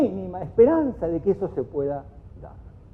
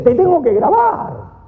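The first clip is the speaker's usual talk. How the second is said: higher, louder and slow: I have to record you; mock-indignant raised voice; exasperated threat; 10 semitones higher, 6 dB louder, slower than usual. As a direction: spell out the threat slowly and pointedly. Quoting someone in an argument.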